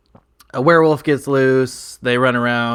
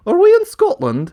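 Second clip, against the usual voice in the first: mock Scottish accent, pitched up and louder; 7 dB louder than usual. The Doctor reacting to everyone speaking Scottish, in a Scottish accent. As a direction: play the Doctor slipping into Scottish. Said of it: "speaking with a Scottish accent".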